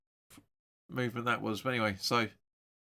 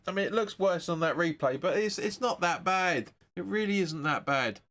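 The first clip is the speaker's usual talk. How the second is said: ordinary talk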